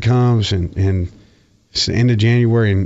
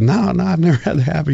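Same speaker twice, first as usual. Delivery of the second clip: mock-innocent, much higher; innocent reply; 8 semitones higher than usual. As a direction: play the wide-eyed young player. His reply to the coach.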